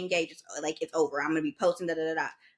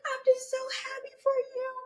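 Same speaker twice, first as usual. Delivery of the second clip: tearful friend. Retold or imitated. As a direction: mimic a friend gushing through tears. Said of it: "She was like".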